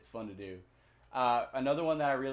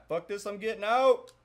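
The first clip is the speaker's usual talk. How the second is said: mock exasperated; 8 semitones higher, 8 dB louder than usual. Naming an escape button.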